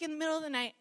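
ordinary talk, nothing marked

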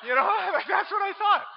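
high, loud laughing through the words; cracking up at his own joke; laughing as he tells the church. Real, not acted.

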